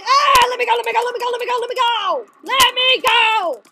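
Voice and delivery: high, loud, frantic repeated plea; trapped and frantically shouting; panicked shouting. Real, not acted. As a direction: yell to be released, stretching the last plea. Caught in net, struggling.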